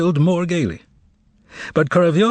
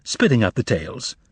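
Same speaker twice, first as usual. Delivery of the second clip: ordinary delivery, nothing marked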